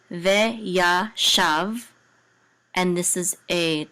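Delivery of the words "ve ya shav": "Ve ya shav" in separate, drawn-out syllables; teacherly, slow sounding-out of each syllable. Shown as a demonstration. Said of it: "close out this syllable".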